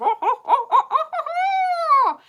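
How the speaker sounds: loud, high laughter. Real, not acted. Laughing at monkey sound.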